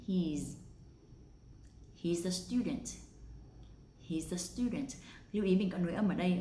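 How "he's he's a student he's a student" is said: "He's" ending in a buzzed z; contracted he's. Shown as a demonstration. Teacher showing the linking, "He's" with a z. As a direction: link the z of "He's" into the next schwa. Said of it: "với một âm z ở cuối".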